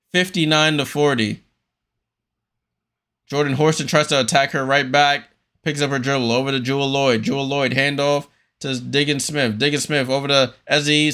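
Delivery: brisk running game call, pass by pass; play-by-play. Acted. Streamer calling the game live for viewers, play-by-play. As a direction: call each pass as it happens.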